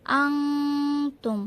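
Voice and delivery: আনতুম with a one-count nasal hum on ন; slow আনতুম with ন nasalised; ghunna demo. Shown as a demonstration. Teacher repeats the ghunna for students, slow. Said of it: "এক আলিফ গুণা করে পড়তে হবে".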